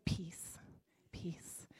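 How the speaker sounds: hushed whispered peace; fading whisper of peace. Shown as a demonstration. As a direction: breathe it softly to the room. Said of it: "Everybody say".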